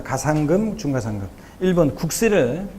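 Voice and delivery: usual speaking voice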